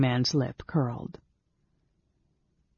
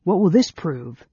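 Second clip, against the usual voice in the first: raised, louder sneering challenge; scornful demand, pitched up; 4 semitones higher, 5 dB louder than usual. A narrator's reading. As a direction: throw the question out with scorn.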